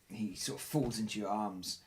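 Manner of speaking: usual speaking voice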